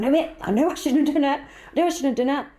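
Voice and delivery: high, fretful self-reproach; anxious regret. Retold or imitated. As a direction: fret that you got it wrong. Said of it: "pacing up and down".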